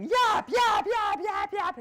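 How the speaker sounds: high, loud yapping like a little dog; yapping inner chatter. Retold or imitated. Doubt nags at her while she sings, loud mock yapping. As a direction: shout the yaps as nagging doubt. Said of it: "starts going".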